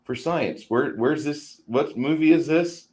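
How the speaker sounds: put-on high, eager young boy; eager kid voice. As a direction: play an overeager twelve-year-old. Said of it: "there's a twelve-year-old boy going".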